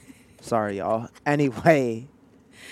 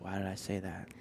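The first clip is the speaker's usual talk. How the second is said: regretful mumble; 4 semitones lower, 14 dB quieter than usual. Retold or imitated. Retold regretful mutter.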